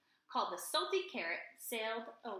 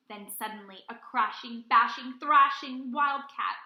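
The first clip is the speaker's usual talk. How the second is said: building storm; 11 dB louder than usual. A narrator's reading.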